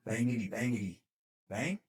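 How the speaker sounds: soft, unsure mock shots with a questioning last word; tentative pretend gunfire. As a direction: try it out timidly, ending on a rise. Private tries his pretend gun, unsure.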